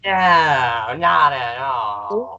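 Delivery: drawn-out sarcastic denial. Real, not acted. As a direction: stretch it out slowly with heavy sarcasm. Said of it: "Sorry, sarcasm".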